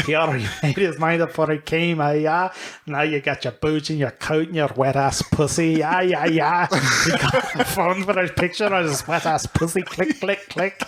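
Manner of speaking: put-on shaky-grasp Scottish accent; badly faked Scottish lilt; attempted Scottish. Comedian reading rap lyrics, badly faked Scottish. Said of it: "some Scottish stuff".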